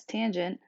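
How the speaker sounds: "tangent" stressed on the first syllable; pronunciation demo. Shown as a demonstration. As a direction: say "tangent" as TAN-junt. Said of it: "which is pronounced".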